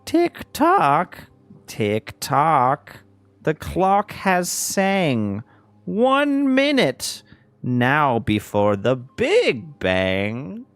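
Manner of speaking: high, loud, slow creepy clown rhyme; drawn-out taunting clown voice; silly clown. Acted. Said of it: "you hear over the speakers again".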